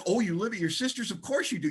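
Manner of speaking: gleeful sponsor thrilled at the mess; imitating an overjoyed sponsor; excited sponsor. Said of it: "he's getting excited".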